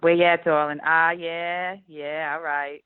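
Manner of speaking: drawn-out New Orleans Yat, a little Brooklyn; New Orleans Yat accent. Shown as a demonstration. Imitating New Orleans locals in a Yat accent. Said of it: "that's the yeah accent".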